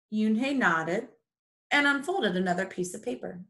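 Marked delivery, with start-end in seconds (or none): none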